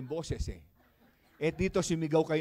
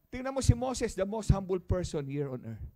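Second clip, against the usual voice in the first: ordinary delivery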